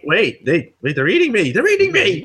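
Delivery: hammed-up cry of being eaten alive; play-acted screaming. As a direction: panic climbing to a high shriek. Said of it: "Yeah, I'm screaming".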